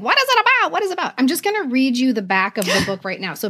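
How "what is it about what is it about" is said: high, insistent mimicked question; eager questioners. Author voices curious people asking about book, eager. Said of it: "because people are like".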